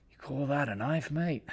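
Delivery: a lightly voiced Australian Mick; Mick impression. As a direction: tease the small knife like Mick. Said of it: "Remember Mick from Crocodile Dundee?".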